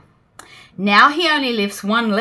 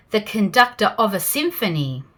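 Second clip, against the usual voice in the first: usual speaking voice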